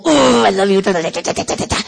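loud, smothering gush; exaggerated, over-loud doting; gushing affection. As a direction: smother someone with noisy love. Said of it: "give him the whole".

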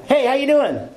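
very high, over-bright greeting; hammed-up chirpy hello; fake breezy cheer. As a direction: pretend everything is fine. Acts himself faking cheer at 1 a.m.

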